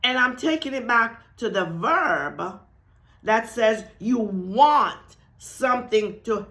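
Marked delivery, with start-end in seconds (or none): none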